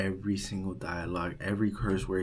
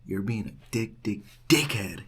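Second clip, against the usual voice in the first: stuttering. Retold or imitated. Student calling the RA a name, stuttering. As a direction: stutter on "dick" before landing "dickhead". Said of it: "He started stuttering".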